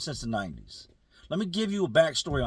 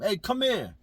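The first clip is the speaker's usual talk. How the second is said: higher-pitched summons, only mildly raised; retold yell; 7 semitones higher than usual. Friend summons a man at the fence, mild retold yell. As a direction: call him over in a mock yell. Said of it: "And he yells at him".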